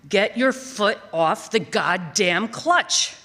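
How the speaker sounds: slow, booming yell at the driver; thunderous yell. Retold or imitated. Father yelling at his daughter behind the wheel, booming and slow. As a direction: bellow it from the passenger seat, slow and heavy. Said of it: "His voice is thunderous".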